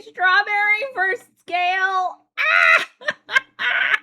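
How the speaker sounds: loud, slow laughing shout; cracking up while reading it out. Real, not acted. Reading funny comment, cracking up.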